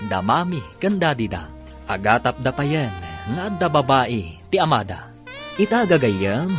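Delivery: ordinary talk, nothing marked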